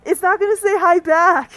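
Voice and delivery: high, loud disbelief; alarmed outburst. Acted. Player scolding friend for greeting the thing in the coffin, loud and alarmed. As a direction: raise your voice, aghast at the idea.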